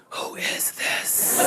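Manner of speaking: very slow, suspicious whisper; menacing whisper. Retold or imitated. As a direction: whisper it slowly, imitating a suspicious caller. Marie grows suspicious of caller.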